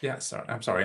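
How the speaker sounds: slightly tipsy, loose speech. Real, not acted. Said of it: "I'm tipsy".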